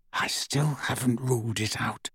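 muffled voice, as if through blankets; muffled under blankets. A narrator's reading. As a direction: speak from under the covers. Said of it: "came Hermione's muffled voice from beneath her blankets".

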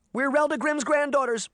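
high, urgent outburst; desperate high-pitched confession; desperate blurt. A narrator's reading. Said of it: "she blurted out desperately".

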